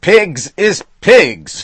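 very high, very loud and slow; firm. A narrator's reading. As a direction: plant the words like a law. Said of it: "Flannery said firmly".

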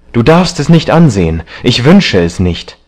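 high, urgent forbidding; alarmed. A narrator's reading. Young man blocking the painter from the screen, alarmed. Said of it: "Ein Ruf des Schreckens kam".

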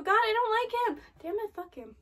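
fast, loud, high venting; exasperated outburst. Real, not acted. Viewer venting about the hated demon, exasperated outburst. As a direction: burst out with real dislike.

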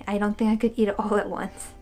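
ordinary delivery

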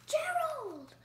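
high, excited little pig calling her friend; Piggy's voice. Acted. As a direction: call out to Gerald high and bright. Piggy voiced in picture book.

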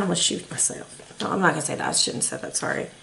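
very soft and low, dropping away; hushed embarrassed retraction. Real, not acted. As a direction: take it back under your breath. Regrets a slip, takes it back.

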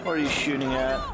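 normal talking voice

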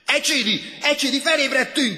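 very high, loud yell; urgent shouting. A narrator's reading. Man shaking his sleeping classmate awake, shouting high. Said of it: "kiabálta".

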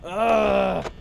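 loud, harsh "ugh"; frustrated groan. Real, not acted. Player fed up with the game, loud groan.

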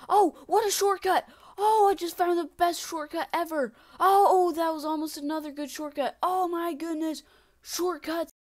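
loud, high, elated shouting; very excited. Real, not acted. Player just found the best shortcut, excited shouting. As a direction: yell with delight at a lucky find. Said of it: "I'm getting so excited".

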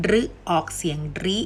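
"ริ" with a short i vowel. Shown as a demonstration. Teacher showing the second of three sounds, short ริ. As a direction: say รึ first, then ริ with a short "ee". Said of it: "จะแบ่งออกเป็นสามเสียง".

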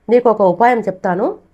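rally him with a plan; firm announcement. Acted. Friend firmly offers a plan.

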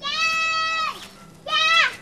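shouting high and loud across a distance; calling out. Acted. Child shouting to father returning with grandmother. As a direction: call your father home, eager.

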